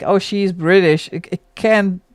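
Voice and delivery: ordinary talk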